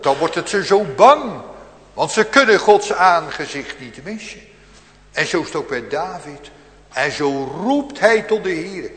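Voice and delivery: rising fervour from the pulpit; preaching. Acted.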